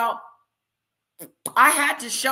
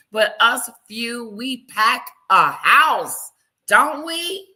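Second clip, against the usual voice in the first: slow, loud, drawn-out rally to the chat; proud hype; 6 dB louder, slower than usual. Real, not acted. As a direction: pump up the small crowd, stretching each word. Hyping up her small chat audience.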